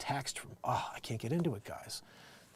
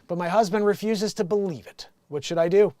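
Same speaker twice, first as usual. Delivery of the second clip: loud, raised read-out voice; letter read aloud; 7 semitones higher, 10 dB louder than usual. Acted. Host reads a Dear Abby headline, projected. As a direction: announce the letter to the audience.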